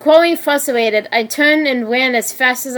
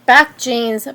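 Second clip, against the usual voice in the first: ordinary delivery, nothing marked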